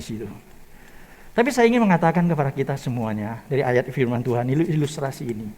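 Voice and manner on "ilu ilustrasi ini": ordinary talk, nothing marked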